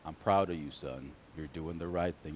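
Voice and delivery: low, hushed fatherly praise; quiet proud father voice; proud dad. Acted. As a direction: praise your son softly, deep in your range. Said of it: "you know what Dad said?".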